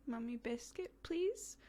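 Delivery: put-on high, soft little-girl request; tiny hesitant please from a toddler; lightly voiced toddler asking; imitating toddler. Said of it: "she paused and she goes".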